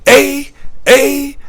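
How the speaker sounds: repeated high, loud Canadian eh; mock the Canadian eh at every ending. Vlogger mocking how the clerk ended lines, high eh. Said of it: "everything she ended".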